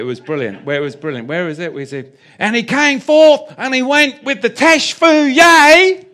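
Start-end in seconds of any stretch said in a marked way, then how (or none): 2.4-6.1 loud, high Arkansas preacher impression; Arkansas accent. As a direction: holler it slowly in a mock Arkansas accent. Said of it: "in an Arkansas accent. I'll do it for you".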